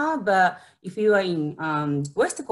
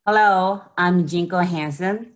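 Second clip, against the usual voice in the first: usual speaking voice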